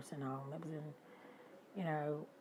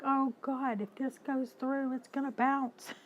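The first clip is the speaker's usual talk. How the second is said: higher and louder, anxious; raised nervous fretting; retold worry; 8 semitones higher, 8 dB louder than usual. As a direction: panic about the payment bouncing. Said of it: "I was like".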